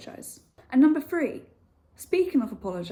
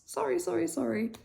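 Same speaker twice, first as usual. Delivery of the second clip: over-apologetic sorry; 7 semitones higher than usual. Shown as a demonstration. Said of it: "just say sorry for absolutely everything".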